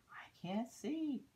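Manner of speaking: high, slow breathy whisper of a small snail; worried little snail. Acted. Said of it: "said the snail".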